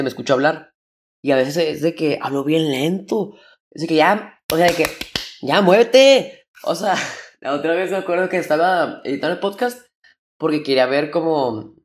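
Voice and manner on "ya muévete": impatient self-scolding. Retold or imitated. Scolding himself while editing.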